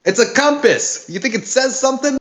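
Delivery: high, snappy Brooklyn shout; Brooklyn accent. Acted. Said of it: "can't get an accent down for Delilah".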